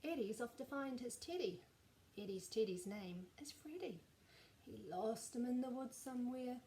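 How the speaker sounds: plain reading voice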